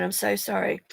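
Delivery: usual speaking voice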